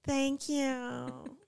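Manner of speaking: drawn-out mock LA thanks; LA accent. Shown as a demonstration. Correcting the co-host's thank you, LA accent. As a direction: stretch it out like an LA girl. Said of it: "from L A".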